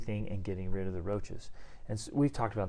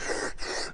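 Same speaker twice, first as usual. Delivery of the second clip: frightened gasp. Retold or imitated. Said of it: "the guy's like".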